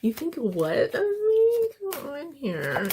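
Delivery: high, slow, gushing; squealing delight. Real, not acted. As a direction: squeal like it's about you. Playful mock reaction to card.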